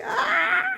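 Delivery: high shout that breaks into a cry-laugh; shriek, then crack into a laugh; panicked yell. Retold or imitated. Panicking as her paid-off Jeep hits the ditch, high shout breaking into laughter.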